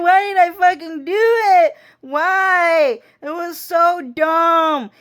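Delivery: high, wailing, near-crying regret; anguished self-reproach. Retold or imitated. Girl berating herself, near tears, high wail. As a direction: cry it out, hating yourself for it. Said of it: "She's like".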